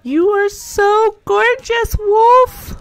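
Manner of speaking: high, loud, slow coo. Real, not acted. Player dotes on her dog Wolf, high baby talk.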